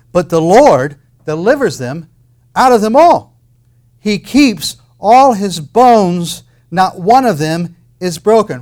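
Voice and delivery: loud, slow, ringing scripture; preaching at full force. Acted. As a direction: proclaim the promise with full force.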